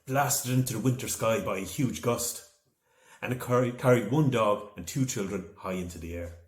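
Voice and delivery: plain reading voice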